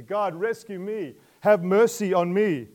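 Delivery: pleading outcry; loud plea. Acted. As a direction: beg God out loud. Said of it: "It's a plea for mercy".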